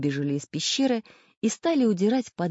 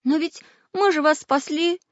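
near tears; 11 semitones higher, 4 dB louder than usual. Acted. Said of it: "чуть не плакал Прутик".

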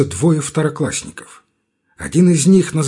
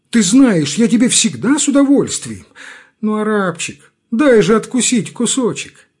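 begging whine; 8 semitones higher, 5 dB louder than usual. A narrator's reading. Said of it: "униженный нищенский голос".

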